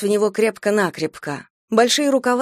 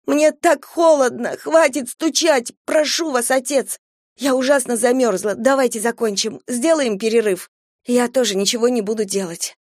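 hysterical pleading; 4 semitones higher than usual. Acted. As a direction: sob out the pleas in hysteria. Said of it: "Марджери билась в истерике".

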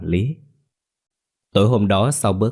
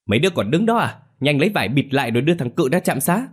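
hasty scolding; 7 semitones higher, faster than usual. A narrator's reading. Đông scolds the young men to rush Cự to clinic, shouting. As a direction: snap the orders at the idle young men. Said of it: "Ông Đông vội quát".

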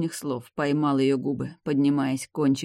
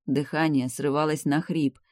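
ordinary delivery, nothing marked

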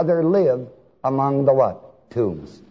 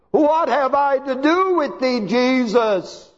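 high, loud anguished cry; 10 semitones higher than usual. Shown as a demonstration. Preacher voicing the spirit crying out to Jesus. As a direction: wail it at Jesus like the demon. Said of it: "he didn't do it as softly as I'm speaking now".

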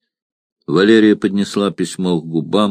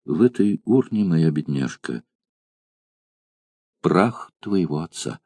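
trembling voice; 3 semitones lower, 6 dB quieter than usual. Acted. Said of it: "дрожащим голосом промолвила".

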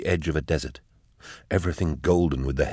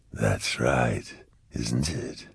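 low, soft taunt; eerie demon voice; 5 semitones lower, 5 dB quieter, much slower than usual. A narrator's reading. Demon voice toys with Eisenhorn, low and eerie. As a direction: drop it deep and hushed.

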